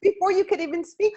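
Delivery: playful high teasing voice; joking. Real, not acted. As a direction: tease her, pitch up high.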